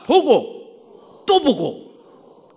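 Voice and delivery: loud, very slow spaced words; call-and-repeat. Shown as a demonstration. Preacher has the congregation say it together, loud slow call-and-repeat. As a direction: lead the crowd word by word, loudly. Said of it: "같이 합시다".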